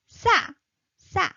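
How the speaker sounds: 싸 said tense and high, a sharp pitch jump; teacher demonstrates tense 싸 to a student. Shown as a demonstration. Teacher models 싸 high and tense. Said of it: "high pitch".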